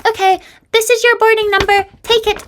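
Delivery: high, loud cartoon clerk voice; boarding desk agent. Desk agent hands over boarding number, high mock-official voice. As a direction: chirp it out like a caricature official. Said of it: "they would be like".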